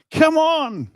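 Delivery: loud high shout to the crowd; rallying cry over the line; excited call. Retold or imitated. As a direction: wave everyone forward with a yell. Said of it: "you look back and go".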